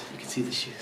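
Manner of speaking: hushed, voiceless whisper. Real, not acted. Pointing out shoes at a neighbour's door, whispering. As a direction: whisper it to your companion. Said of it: "I don't know why I'm whispering".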